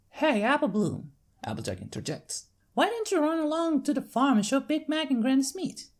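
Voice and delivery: friendly big-sister country voice; Applejack's country accent. Acted. Applejack sending her sister to the farm, Southern accent.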